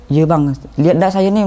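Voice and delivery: eager call to the rescuers. Retold or imitated. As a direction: wave them over with your voice. Answering rescuers from above.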